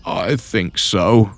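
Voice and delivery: slow pained groan. A narrator's reading. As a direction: squeeze it out through the pain. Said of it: "Rob groaned in agony".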